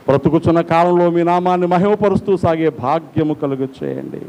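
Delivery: ordinary delivery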